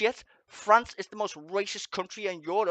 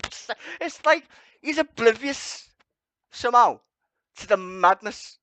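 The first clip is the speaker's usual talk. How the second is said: exasperated; 5 semitones higher, 6 dB louder than usual. Real, not acted. Exasperated rant.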